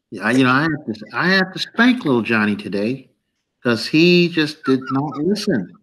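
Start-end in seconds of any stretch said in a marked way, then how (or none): none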